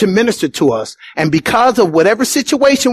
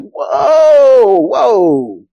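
incredulous townsfolk; 7 semitones higher than usual. Retold or imitated. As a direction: scoff in disbelief at the carpenter's boy. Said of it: "they were like".

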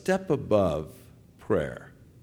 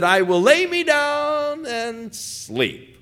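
slow, high, ringing recitation of the psalm line; psalm quoted; 13 semitones higher, 5 dB louder, slower than usual. Acted. Preacher intoning David's words from the psalm, slow and lifted. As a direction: lift it and stretch it out like scripture.

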